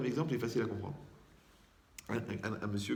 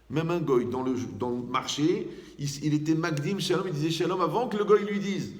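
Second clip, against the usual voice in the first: rising preaching emphasis; 4 semitones higher, 5 dB louder than usual. Real, not acted. Preacher builds to emphatic point.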